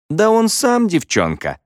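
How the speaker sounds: high-pitched, taunting dig; snide jibe. A narrator's reading. Girl mocking Мо from behind, snide. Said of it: "съязвила Клэр Уилкинс".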